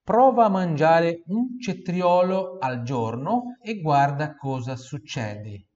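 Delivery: Prova a mangiare said loud and slow, clear Italian; loud slow model sentence. Shown as a demonstration. Teacher reads the Italian phrase loudly for students to repeat. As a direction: read the sentence out loud for repetition. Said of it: "Repita em voz alta".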